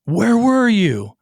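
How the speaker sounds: high, slow, half-mock yell; yelling at his son for wandering off; retold yelling. Dad yells at son left at church, high and slow. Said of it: "And I start yelling at him".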